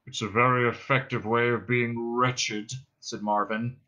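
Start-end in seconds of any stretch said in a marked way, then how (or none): 0.1-2.7 dreary, even drone; depressed robot. Acted. Marvin explains lying in the dust, dejected drone. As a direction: state it in a wretched monotone.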